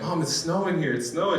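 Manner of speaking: put-on like a kid seeing snow for the first time; calling home about first snow, excited; excited news.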